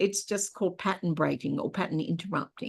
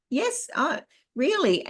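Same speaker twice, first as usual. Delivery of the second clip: sweet smile; 9 semitones higher, slower than usual. Shown as a demonstration. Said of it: "you just smile and you go".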